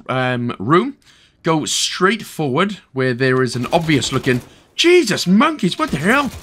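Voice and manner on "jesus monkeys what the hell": high, startled yelp of alarm; startled outburst. Real, not acted. Player caught off guard mid-explanation, startled outburst. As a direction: blurt it out, pitch leaping up.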